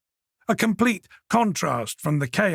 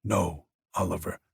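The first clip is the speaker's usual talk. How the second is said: lower, contemptuous and controlled; cold menace held in check; Peter's cold voice; 6 semitones lower than usual. A narrator's reading. Peter to the traitor Oliver, low and contemptuous.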